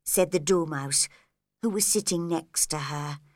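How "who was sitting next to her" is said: plain reading voice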